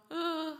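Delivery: a short high, loud mock whine of a little girl refusing to walk; playful toddler squeal; imitated toddler protest. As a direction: mimic a toddler's stubborn little "Åh". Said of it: "Hon liksom".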